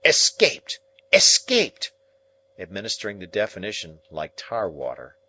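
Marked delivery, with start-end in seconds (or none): 0.0-0.8 loud, higher snappish shout; sharp impatient sister. Acted. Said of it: "said snappishly". 1.1-1.9 higher and louder snapping repeat; snappish repeat. Acted. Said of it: "said snappishly".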